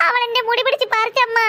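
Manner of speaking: sobbing as she tells; tearful complaint. Acted. Child accuses sister of hair-pulling, sobbing. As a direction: plead through childish crying.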